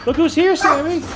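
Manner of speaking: high sing-song dog talk. Real, not acted. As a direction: coo at the dog, pitched well up. Greeting the dog.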